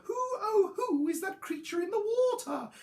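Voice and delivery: high hooting dinosaur voice; high, awed little Drip; wondering youngster. A narrator's reading. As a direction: ask in high-pitched amazement. Drip wondering at his reflection.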